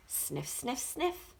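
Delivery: quick soft sniffs, rising; sniffing sounds. Acted. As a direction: sniff like a dog on a scent. Dog sniffing at lights.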